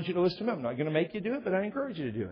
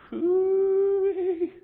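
high, loud, held whoo-wee; a drawn-out comic holler of amazement; a high, loud whoop about the wife's temper; 5 dB louder than usual. Real, not acted. Wife's anger, comic exclamation.

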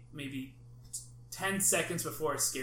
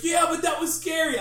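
much higher, louder, defensive squeal; scared friend's protest; 12 semitones higher, 9 dB louder than usual. Retold or imitated. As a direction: whine it like you just got terrified. Voicing scared friend Ian.

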